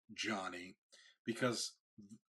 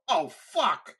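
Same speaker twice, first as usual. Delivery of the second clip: higher, louder sudden yelp; imitated surprised swear, much louder and higher; retold pained curse; 9 semitones higher, 9 dB louder than usual. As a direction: blurt it as dust hits your eye. Dust falling into his eye.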